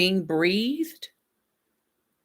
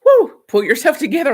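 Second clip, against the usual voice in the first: mocking exclamation; 9 semitones higher, 11 dB louder, faster than usual. Mocking the uncle's advice.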